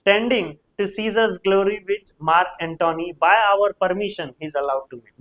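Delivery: very slow declaiming; slow recitation. Shown as a demonstration. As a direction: stretch the line out slowly. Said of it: "okay".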